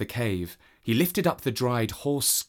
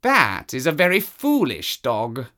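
a cat sneering at the dog; scornful cat; 3 semitones higher, 4 dB louder than usual. Acted. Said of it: "said the cat, listening".

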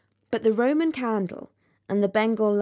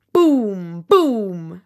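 booming sound; 7 dB louder than usual. A narrator's reading. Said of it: "went the Roman candle".

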